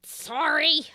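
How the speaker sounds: high, loud, drawn-out call; distant apology. Acted. Woman upstairs answering the scolding, slow high yell. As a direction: shout back a stretched-out sorry. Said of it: "you hear that voice go".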